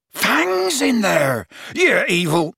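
long, high, anguished bellow. A narrator's reading. Hagrid desperate for his trapped dog, bellowing. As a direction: bellow it slowly in outrage. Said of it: "Hagrid bellowed".

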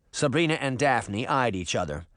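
ordinary delivery

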